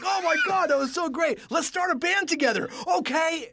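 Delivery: high, loud retold excitement; thrilled enthusiasm. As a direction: gush like a teenager to a new bandmate. Retells excited decision to form band.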